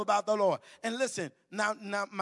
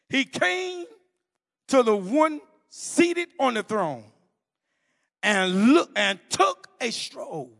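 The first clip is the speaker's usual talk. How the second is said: slow, weighty reading; scripture reading; 5 dB louder, slower than usual. Acted. Preacher reading Revelation to the conference, slow and solemn. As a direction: let each image land.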